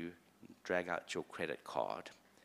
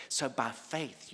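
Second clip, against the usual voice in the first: ordinary talk, nothing marked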